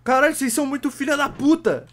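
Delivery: loud, high, exasperated scolding; angry rant. Real, not acted. Streamer scolds chat for bad tips, angry rant. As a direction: chew the chat out.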